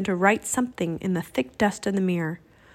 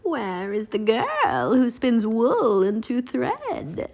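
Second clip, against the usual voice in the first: slow, drawn-out eerie recital; stretched-out, singsong verse; reciting; slower than usual. A narrator's reading. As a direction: recite it like a mysterious riddle. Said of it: "and then she recited".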